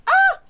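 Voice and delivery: high, loud startled yelp; sudden fright scream; startled shriek. Retold or imitated. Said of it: "and I'm like".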